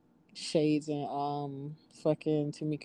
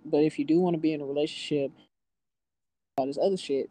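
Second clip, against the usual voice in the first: ordinary talk, nothing marked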